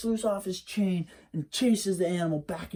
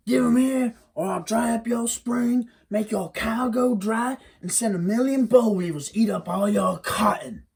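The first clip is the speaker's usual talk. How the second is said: higher and louder, a menacing demand; angry threat; 6 semitones higher, 3 dB louder than usual. Acted. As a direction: bark the threat, fading to a harsh whisper. Hairy man threatens loudly.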